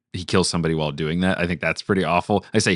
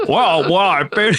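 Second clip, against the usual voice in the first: Jimmy Stewart impression; 9 semitones higher, 5 dB louder than usual.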